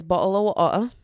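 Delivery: clumsy attempt at a British accent; bad British accent. Shown as a demonstration. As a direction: try British, swallowing the t's. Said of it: "in a British accent".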